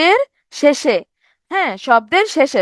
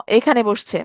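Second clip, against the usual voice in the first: normal talking voice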